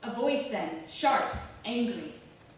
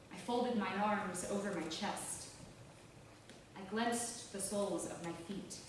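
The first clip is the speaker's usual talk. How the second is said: plain reading voice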